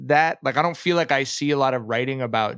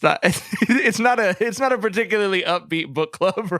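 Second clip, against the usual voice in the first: laughing; 7 semitones higher than usual. Real, not acted. Laughing about gloomy book pick.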